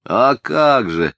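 pompous boy, lightly voiced; self-important. A narrator's reading. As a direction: answer with drawn-out importance. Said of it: "важно сказал мальчик".

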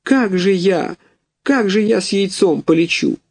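a goose too heavy to fly, in tears; mild crying goose voice; tearful mother goose. A narrator's reading. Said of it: "расплакалась".

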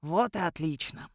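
тихо и ниже обычного, довольно; скажи довольно, без спешки; удовлетворённо. A narrator's reading. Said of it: "удовлетворённо произнёс смотритель". (смотритель удовлетворённо, тише и ниже)

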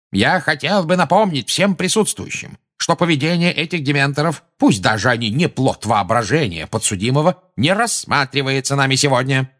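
high, insistent official voice; pompous, heated ruling from the chair; indignant objection. A narrator's reading. Indignant minister addressing the hearing.

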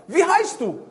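high, loud insistent call. Acted. Hunter finding nobody there, high insistent call. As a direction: shout the question into the empty woods.

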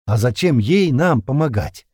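hostile question. A narrator's reading. Suspicious prisoner asks the captain about the girl, hostile. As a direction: ask it with open distaste. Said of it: "с неприязнью поинтересовался Леднев".

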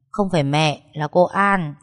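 ordinary delivery, nothing marked

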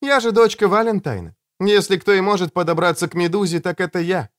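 put-on high-pitched girl's voice; girl insisting she can manage it; self-assured girl. A narrator's reading. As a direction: say it high, asserting who you are.

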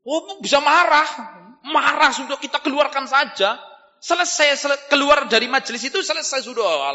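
high-pitched, animated preacher's voice; insistent rhetorical preaching; heated preaching. Acted. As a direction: argue the point with rising heat. Preaches with raised voice.

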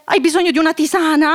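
ordinary talk

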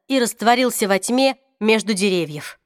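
plain reading voice

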